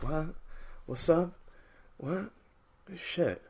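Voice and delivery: quiet, groggy one-word replies; soft and spaced out, with gaps; drugged-out mumbler. Retold or imitated. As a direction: imitate a dazed guy on codeine. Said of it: "motherfucker's on codeine and shit".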